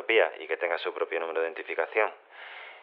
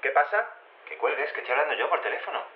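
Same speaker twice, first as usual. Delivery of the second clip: annoyed, quick and raised; irritated; 8 semitones higher than usual. Acted. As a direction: snap at the clone to hang up. Clone interrupting phone call. (irritado porque su clon le interrumpe la llamada)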